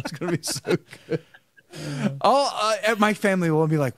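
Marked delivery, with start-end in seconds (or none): 0.0-1.2 laughing. Real, not acted. Still laughing after the joke.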